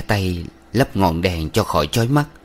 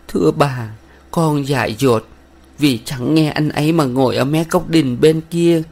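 tearful; 7 semitones higher than usual. A narrator's reading. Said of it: "rồi nén mãi nó mới nói".

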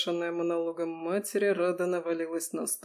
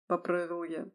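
usual speaking voice

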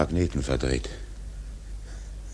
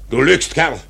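higher, louder accusing bark; furious captain calling out a liar; angry outburst; 7 semitones higher, 6 dB louder than usual. Acted. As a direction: snap at him for lying. Captain accuses helmsman of lying.

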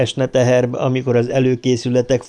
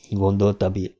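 ordinary delivery

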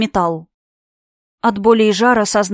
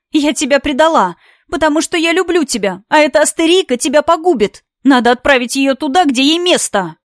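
higher, louder passionate plea; passionate outburst; 5 semitones higher, 3 dB louder than usual. Acted. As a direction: pour out love and rage.

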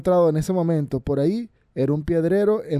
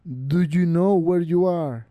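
slow, echoing voice from beyond the grave; deep echoing underworld god; 2 semitones higher, slower than usual. A narrator's reading. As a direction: repeat it slowly like a voice from the tomb. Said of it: "El viejo repitió".

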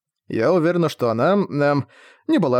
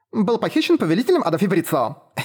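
innocent smile; 6 semitones higher than usual. A narrator's reading. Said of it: "я невинно улыбнулась ей".

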